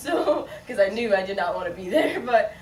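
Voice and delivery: talking through real laughter; laughing. Real, not acted. Laughing about avoiding the West Coast.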